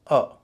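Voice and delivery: "अ" as a short neutral uh; reduced vowel. Shown as a demonstration. Teacher showing how letter A sounds, reduced vowel. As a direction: say "अ" as a light schwa. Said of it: "ए को बोला जाएगा".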